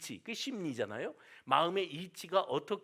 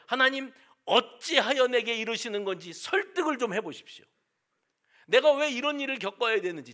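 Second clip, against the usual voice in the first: raised, insistent complaint to God; pressing God for an answer, voice up and louder; demanding why; 5 semitones higher, 6 dB louder than usual. Retold or imitated. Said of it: "하고 따져 물을 때". (하나님께 따지듯 높고 큰 목소리로)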